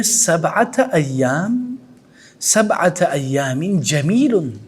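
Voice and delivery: slow, drawn-out Arabic phrase; father's slow line. Retold or imitated. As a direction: stretch each word out slowly. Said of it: "ابو کہتے ہیں". (والد کی دھیمی، کھنچی ہوئی آواز)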